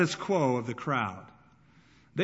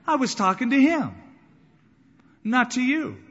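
indignant retort; 9 semitones higher, 7 dB louder than usual. Retold or imitated. Young man rebukes the elders.

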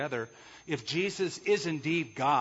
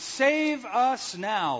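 pleading cry; 9 semitones higher, 5 dB louder, much slower than usual. Retold or imitated. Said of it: "And they shouted".

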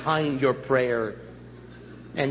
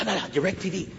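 normal talking voice

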